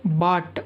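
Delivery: బాట్ said as one syllable, silent "gh"; pronunciation demo. Shown as a demonstration. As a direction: model బాట్ for learners as "baat". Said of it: "బాట్ అని పలకాలి".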